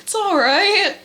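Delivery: high-pitched, lilting reassurance; imitated high, soothing reply; Jesus reassuring. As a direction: pitch it way up, consoling. Acting Jesus comforting, high.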